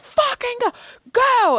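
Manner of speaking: very high, acted-out yell at a dog; retold screaming. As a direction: re-enact screaming at a dog to leave. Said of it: "I started screaming at him".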